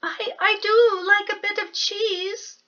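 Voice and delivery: high, hesitant little mouse voice; timid stammering mouse. Acted. Mouse nervously asking Bear for cheese, high. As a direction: stammer out a request. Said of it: "said the mouse".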